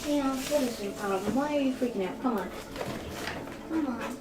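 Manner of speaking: soft, hushed coaxing; quiet coaxing to dog. Real, not acted. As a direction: gently coax a pet, very softly.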